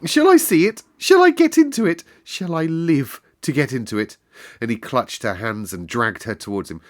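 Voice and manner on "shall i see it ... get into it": high, loud frantic questions; hysterical. Acted. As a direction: beg breathlessly. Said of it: "weak and rather hysterical".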